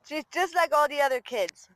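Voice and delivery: ordinary delivery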